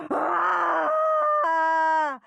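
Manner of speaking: high scream from behind a door; distant scream. A narrator's reading. Girl in the nurse's office, terrible scream. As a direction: scream as if the needle hurts. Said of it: "came the terrible screams".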